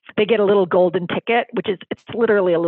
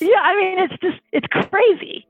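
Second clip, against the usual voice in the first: much higher, excited voice; real excitement; 11 semitones higher than usual. Real, not acted. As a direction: let the excitement lift your voice right up. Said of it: "I can hear the excitement in your voice".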